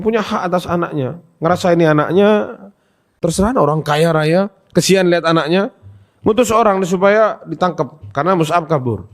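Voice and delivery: ordinary talk, nothing marked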